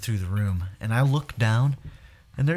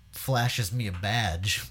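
ordinary delivery

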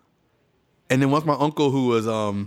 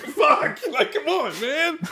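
high, laughing "come on, man"; crying-laughing plea; laughing protest; slower than usual. Retold or imitated. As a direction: laugh it out in mock exasperation. Overcome while brother praises him.

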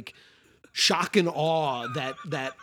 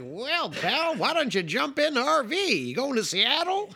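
put-on much higher folksy old-timer voice; old prospector; 11 semitones higher than usual. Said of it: "he's like an old prospector".

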